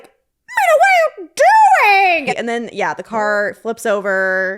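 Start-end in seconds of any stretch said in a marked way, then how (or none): 0.0-2.4 much higher, louder mock mother shriek; shrieking mom. Mother shrieking at Mina in the car, much higher, louder. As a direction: screech at the child in the back seat. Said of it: "the mom was like".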